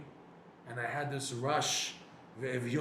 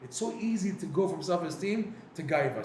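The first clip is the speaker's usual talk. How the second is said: ordinary talk, nothing marked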